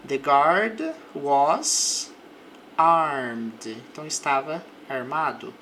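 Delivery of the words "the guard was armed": very slow, louder English example; careful drilled sentence; slow model sentence. Shown as a demonstration. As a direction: spell it out slowly for students. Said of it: "A boca ela fica mais solta".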